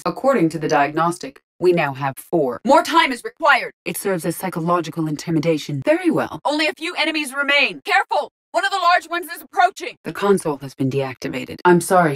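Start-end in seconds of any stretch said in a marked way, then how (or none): 2.6-3.7 high, loud call for time; urgent combat plea. Acted. Calling out under combat pressure. 5.8-9.9 very high-pitched battle warnings; urgent combat call-outs. Acted.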